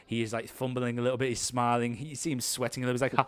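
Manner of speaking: ordinary talk, nothing marked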